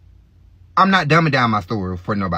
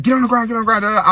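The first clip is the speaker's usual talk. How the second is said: retold police shout; 8 semitones higher, much faster than usual. Police yelling at the blood-covered teen, retold shout. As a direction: yell it like a cop at a suspect.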